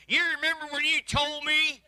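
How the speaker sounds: high, loud reproach; accusing. Retold or imitated. Hurt spouse recalling an old insult, loud. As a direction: throw it back at him. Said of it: "they'll say".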